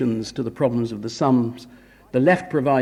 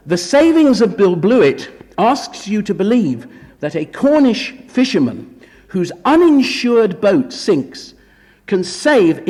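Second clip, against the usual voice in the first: higher, emphatic show of disbelief; pitched-up sceptical emphasis; mock incredulity; 7 semitones higher, 4 dB louder than usual. Real, not acted. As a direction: lay out the plot as plainly preposterous. Mocks the film's implausible plot.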